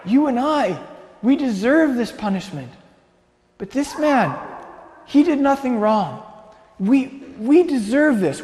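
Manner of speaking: pained, sincere admonishment without shouting; rebuking criminal. Acted. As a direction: plead the innocent man's case to an angry companion. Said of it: "and he said".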